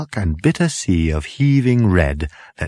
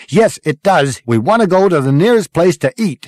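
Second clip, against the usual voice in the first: louder, higher-pitched correction; insistent; 10 semitones higher, 6 dB louder than usual. A narrator's reading. Crew member corrects Zaphod's destination, insistent. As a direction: overrule firmly and eagerly.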